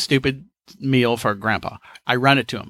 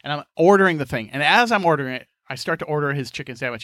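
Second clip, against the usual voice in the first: ordinary delivery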